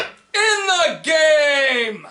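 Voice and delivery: high, long drawn-out holler; mock rallying shout. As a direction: stretch it into a playful, belted echo. Joking echo of co-host's shout.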